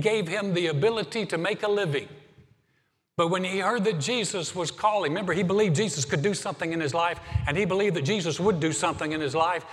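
the preacher driving the point home to the church, loud and fervent; preaching at full force. Acted. Preaching climax.

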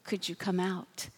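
barely above a breath, coaxing; gentle whisper. Pretend. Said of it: "he's not whispering to your heart today".

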